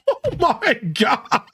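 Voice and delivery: cracking up in a high voice; real laughter breaking through; laughing. Real, not acted. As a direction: laugh it out, very slow. Laughing at own surfer voice.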